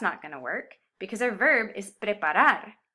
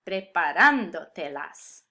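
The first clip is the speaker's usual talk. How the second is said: preparándotelas with a slow, strong "RAN"; preparándotelas, leaning on "rán" then light endings; stress kept in place; 3 semitones lower, 4 dB louder, slower than usual. Shown as a demonstration. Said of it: "keep the emphasis where it belongs".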